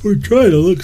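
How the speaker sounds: talking through a yawn. Real, not acted. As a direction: yawn while saying it. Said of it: "Excuse my yawns".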